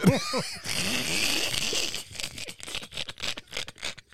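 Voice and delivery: high breathless wheeze of a laugh; silent laugh. Real, not acted. Helpless laughter.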